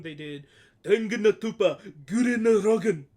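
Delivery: "Tengen no Toppa Gurren Lagann" belted loud in Japanese style; hammed-up Japanese title. Shown as a demonstration. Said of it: "it's fun to say that".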